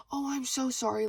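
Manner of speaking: high-pitched, gushing retold sorry; effusive apology. Customer to shift lead, apologizing effusively. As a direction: imitate an over-polite apology, pitch up.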